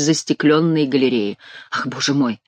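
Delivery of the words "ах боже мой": ordinary delivery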